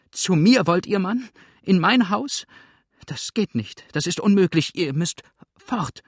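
high, loud alarmed woman; horrified refusal. A narrator's reading. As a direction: recoil in horror from the demand. Horrified widow refuses the fugitive.

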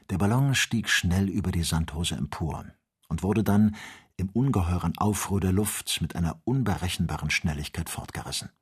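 plain reading voice

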